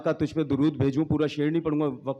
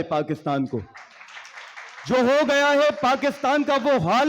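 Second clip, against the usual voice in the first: impassioned verse, higher on rallying lines; poetry recitation; 3 semitones higher than usual. Acted. Poet praying for Pakistan before the forum, declamatory recitation. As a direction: declaim the poem in measured lines with pauses. Recites his poem to audience.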